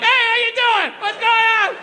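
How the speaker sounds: high, cheery hello; bright casual hello to the priest; breezy greeting. Retold or imitated. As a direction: act like nothing happened. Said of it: "my dad just comes walking like".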